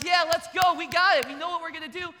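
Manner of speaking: hyped basketball players cheering loudly in a huddle; team huddle cheer. Retold or imitated. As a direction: pump up the team like a locker-room huddle. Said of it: "They're like".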